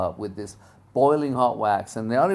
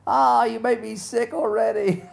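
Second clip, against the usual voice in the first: jealous groan; 12 semitones higher, 4 dB louder than usual. Retold or imitated. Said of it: "I know some of you ... are like".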